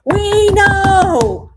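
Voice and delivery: sudden loud shout at the screen; loud outburst. Real, not acted. Viewer shouting back at the TV, loud outburst. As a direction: blurt it out loud at the TV. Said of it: "That was probably loud".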